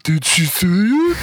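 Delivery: slow, high-pitched dopey goblin voice; big dumb goblin. Retold or imitated. Dumb goblin asks the others about her, high dopey voice. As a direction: play a dim-witted creature, high and drawn out. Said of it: "the big fucking dumb one who's like".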